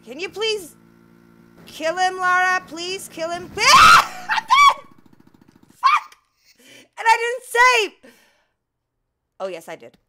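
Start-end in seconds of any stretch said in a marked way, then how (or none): 0.0-3.5 urgent pleading. Real, not acted. Begging her character mid-fight. 3.6-4.3 piercing laughing shriek; shrill scream with a laugh. Real, not acted. Player shrieks as her character is killed. 4.3-8.1 very high, loud wail; shrieking despair. Real, not acted. Player screams at dying without saving. Character just died.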